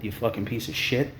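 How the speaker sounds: ordinary delivery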